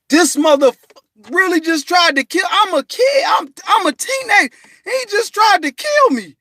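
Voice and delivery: high, loud outraged yell; furious shout. Retold or imitated. Teenager shouting at his shooter, furious. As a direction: rage at the man who shot you. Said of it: "I was mad as shit".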